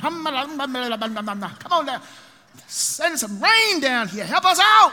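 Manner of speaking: high, slow pleading cry building to a shout; prophets crying out. Acted. Baal's prophets begging their god for rain. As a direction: wail to the sky begging for rain. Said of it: "Ahab's prophets cry out".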